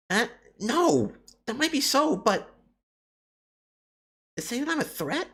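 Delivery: put-on squeaky hurried kappa girl; high girl voice. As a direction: brush them off in a high hurried voice. Same character, flustered denial.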